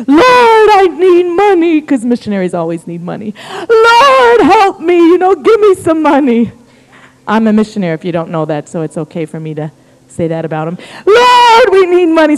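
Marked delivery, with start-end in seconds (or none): 0.1-1.8 loud, high mock wail to heaven; pleading missionary. Missionary on some beach begging God, mock wailing. Mimics needy missionary praying. 3.7-6.5 hammed-up high wail, easing as it goes; pleading missionary. Missionary begging God for money, mock wailing. Mimics needy missionary praying. 11.1-12.4 loud, high mock wail; pleading missionaries. Missionaries crying to the Lord for funds, mock wailing.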